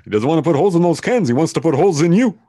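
high, loud, fast frantic movie-quote shout; hammed-up yell. Podcaster quoting an old comedy warning, hammed-up high shout. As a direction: yell the warning in mock alarm.

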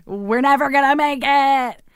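high, slow mock wail of defeat; retold despair. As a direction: stretch it out like a hammed-up lament. Acting out past despair, then corrects.

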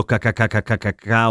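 repeated hard "ca ca ca" syllables. Shown as a demonstration. Showing listeners how awkward humming on palatals is, hard repeated "ca". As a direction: rattle off "ca ca ca" on hard C. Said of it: "oggettivamente meno scorrevole canticchiare dicendo".

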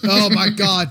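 ordinary talk

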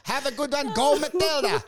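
hammed-up broken English with a Ukrainian accent; Zelensky. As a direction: put on a thick Ukrainian accent.

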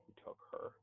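hushed unvoiced whisper. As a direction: breathe it like a scandal. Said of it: "fitting term".

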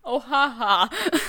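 loud, slow fake ha-ha; sarcastic laugh. Mock laugh at the friend's dodge.

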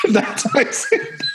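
giggling through the words; high, slow, laughing it out. Real, not acted. Laughing while repeating the hashtag.

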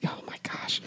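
hushed breathy whisper of recognition. Real, not acted. Remembering the catwalk song, a sudden hushed whisper. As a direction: whisper it as it clicks.

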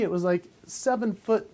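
normal talking voice

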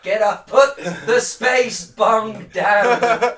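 high, loud mock plea to a space hippie; calling out. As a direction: yell up at the stoner god, slow.

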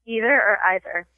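"either" as EE-ther, then EYE-ther; two pronunciations. Shown as a demonstration. Host quizzing a guest, "either" said both ways. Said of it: "I have a pronunciation test".

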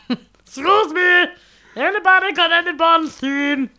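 high, slow lost singer; silly high voice. Pretend. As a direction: ask around, high and slow.

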